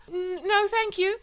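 high, stammering, panicky refusal; shrill boy. Acted. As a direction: squeak out a polite no. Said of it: "said Paul shrilly".

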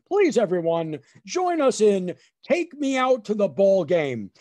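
loud, measured announcer voice; stadium announcer. Retold or imitated. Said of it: "then I hear the announcer".

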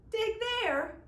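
high, loud disbelieving echo; incredulous. Acted. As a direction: throw the words back in disbelief. Michael echoes in disbelief.

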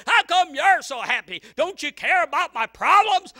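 a bitter neighbour complaining loudly; mock angry complaint. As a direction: hurl the complaint at them. Mad people complaining.

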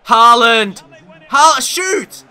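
high, loud yells at the striker; urgent shout. Real, not acted. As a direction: yell at him to shoot now. Urging striker to shoot.

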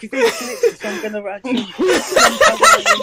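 high, loud, laughing; laughing outburst. Real, not acted. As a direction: blurt it out laughing.

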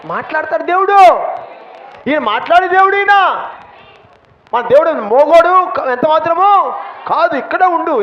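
fervent sermon climax, shouted high; preaching shout. Real, not acted. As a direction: thunder it to the congregation. Preacher erupts about God speaking.

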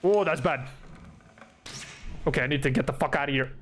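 ordinary talk, nothing marked

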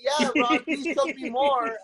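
mock him, dripping with sarcasm; sarcastic. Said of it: "what the fuck am I supposed to say?".

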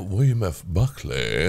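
a hint of a Northeastern gentleman's voice; William F. Buckley. Shown as a demonstration. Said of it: "I do my lines like this".

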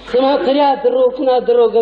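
ordinary talk, nothing marked

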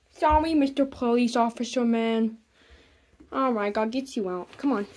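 ordinary delivery

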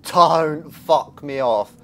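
loud, high, drawn-out yell; frightened shout. Real, not acted. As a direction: shout as the scare hits. Jumpscare reaction.

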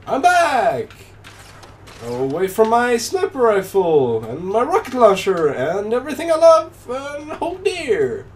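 high, swooping mock-tragic cry; hammed-up despair, rising high; melodramatic lament. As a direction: wail it theatrically, stretching the last words. Mock lament after respawning.

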